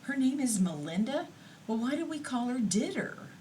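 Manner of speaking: high, astonished question; surprised. Retold or imitated. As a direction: sound amazed at the news. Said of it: "And I'm going".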